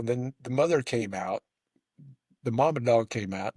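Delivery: normal talking voice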